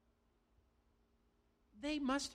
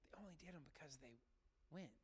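"They only did them because they went" dropped much softer, almost under the breath; softer repeat; 4 semitones lower, 17 dB quieter, faster than usual. Real, not acted. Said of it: "But they only did them because they went".

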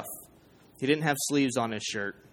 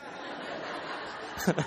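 imitated wheezy laugh; 3 semitones higher, 8 dB quieter than usual. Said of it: "the teardrop guy was like".

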